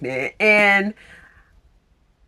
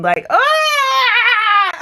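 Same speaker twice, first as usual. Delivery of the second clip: high crying wail of emotion; crying outburst; 4 dB louder than usual. Real, not acted. Reader overwhelmed by a tender scene, crying ah. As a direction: let out an overwhelmed sobbing ah. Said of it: "I'm crying".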